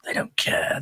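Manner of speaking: whispered worried aside; anxious inner whisper. Retold or imitated. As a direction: breathe it to yourself, anxious. Retold anxious inner thought.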